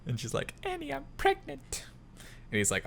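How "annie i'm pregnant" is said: very high, soft falsetto announcement; Padmé falsetto. Retold or imitated. Padmé telling Anakin she's pregnant, in falsetto. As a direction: squeak it out in a girly falsetto.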